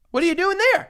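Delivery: high, loud mock challenge; incredulous. Real, not acted. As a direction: call out the move.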